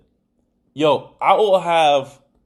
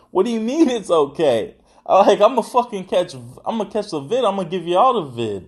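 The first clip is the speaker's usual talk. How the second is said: higher-pitched, worked-up disbelief; agitated protest; 5 semitones higher than usual. Retold or imitated. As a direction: protest like you're stressing out, pitch up. Acting out his stressed protest.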